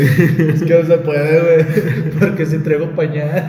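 words broken by laughter; can't hold the laugh in; stifled giggles. Real, not acted. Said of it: "Nos estamos aguantando la risa".

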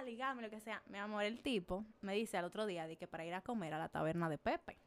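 ordinary delivery

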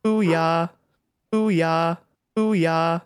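sampled booyah. Shown as a demonstration. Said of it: "I could also sample things I say".

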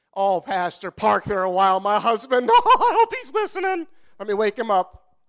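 lightly voiced, gleeful church lady with a giggle; mock churchgoer wife. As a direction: play the wife nudging the pastor, laughing. Mimics a congregation member.

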